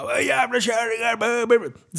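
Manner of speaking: half-voiced rant trailing into nonsense; mock yelling. Mimicking a woman screaming at pensioners with walkers, mock yell. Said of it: "stått och skrikit på någon".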